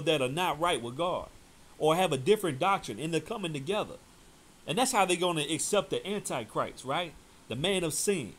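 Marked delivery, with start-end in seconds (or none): none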